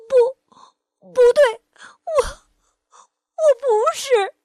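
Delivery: high, loud, sobbing no; a little dinosaur wailing in tears; sobbing child's voice; crying denial. Acted. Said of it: "哭着跑了".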